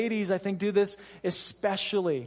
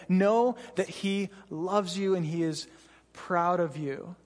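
normal talking voice

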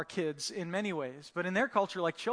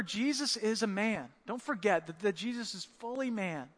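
normal talking voice

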